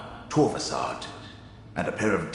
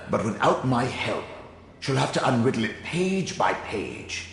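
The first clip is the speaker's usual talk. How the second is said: ordinary delivery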